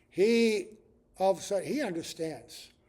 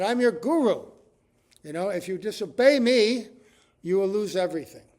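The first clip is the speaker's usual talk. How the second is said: loud, raised warning; retold indignant threat, louder; upset guru; 4 semitones higher, 11 dB louder than usual. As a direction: scold a disobedient disciple, loudly. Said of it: "very, um, upset".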